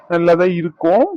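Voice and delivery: sarcastic put-on voice rising at the end; mock imitation of sister. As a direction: mimic her sarcasm in a funny voice. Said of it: "என்ன, என்ன சொன்ன?". (அக்காவை கேலியாக நகலெடுத்துப் பேசுதல்)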